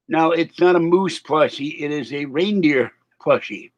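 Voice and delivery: ordinary talk, nothing marked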